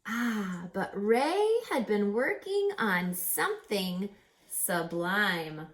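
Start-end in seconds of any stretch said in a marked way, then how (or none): none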